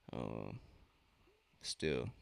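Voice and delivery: ordinary talk, nothing marked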